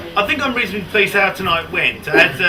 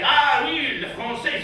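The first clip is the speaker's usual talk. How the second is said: mock French; 6 semitones higher, slower than usual. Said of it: "whenever you see the French".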